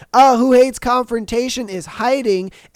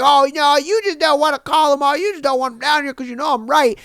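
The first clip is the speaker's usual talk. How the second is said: higher, whiny accusing customer; mocking customer; 5 semitones higher than usual. Worker mimics an angry drive-thru customer, whiny and accusing. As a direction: mimic the pushy customer who won't let it go.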